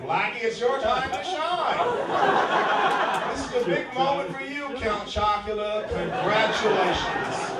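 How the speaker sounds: loud, high, fawning mock voice. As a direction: gush over him with smug condescension. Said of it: "You might as well, like".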